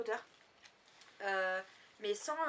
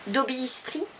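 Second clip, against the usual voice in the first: louder, hammed-up French-tinged English accent; 3 semitones higher, 5 dB louder than usual. Vlogger quoting Dobby for viewers, self-mocking English accent. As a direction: proudly attempt an English accent. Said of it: "Avec mon magnifique accent anglais".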